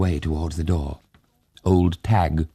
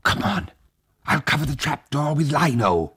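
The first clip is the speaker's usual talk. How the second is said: urgent; 10 semitones higher than usual. A narrator's reading. Urgent plea in danger.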